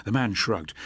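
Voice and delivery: usual speaking voice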